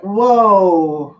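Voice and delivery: long drawn-out, falling "whoa"; re-enacted stunned reaction. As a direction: let out a big stretched whoa, sliding down. Acting out own shocked reaction.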